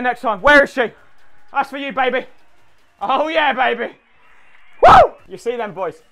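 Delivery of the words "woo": very high, loud whoop; celebratory whoop. Real, not acted. Winning boxer celebrating, shrieked whoop. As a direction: let out a victory whoop.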